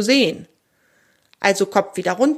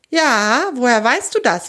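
higher, wary question; somewhat sceptical; 4 semitones higher, 4 dB louder than usual. A narrator's reading. Squirrel questions the strange animal, somewhat sceptical. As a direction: ask with mild suspicion. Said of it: "immer noch etwas skeptisch".

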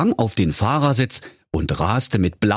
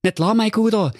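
high goofy cartoon voice; a dim, cheerful fool musing, pitched way up; Holger Honk's voice; 14 semitones higher than usual. A narrator's reading. Honk thinks about flowers for his mother, high goofy voice. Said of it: "Und der Holger Honk sprach".

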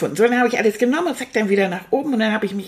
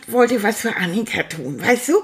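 ordinary talk, nothing marked

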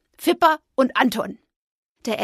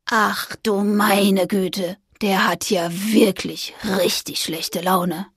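awed ghost; 2 semitones lower, slower than usual. Acted. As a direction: drawl out the dismay like a spooked ghost. Ghost Anton remarks.

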